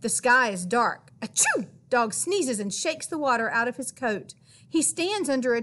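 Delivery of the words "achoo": high, comic achoo; sneeze. A narrator's reading. Reading to kids, wet Dog gives a high sneezy achoo. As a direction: act out a big sneeze.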